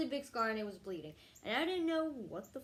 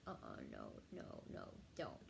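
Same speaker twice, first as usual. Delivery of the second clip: hushed, much lower no no no; secretly refusing, softly; whispered refusal; 12 dB quieter than usual. Retold or imitated. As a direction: whisper it firmly to yourself. Refusing to pee, inner voice.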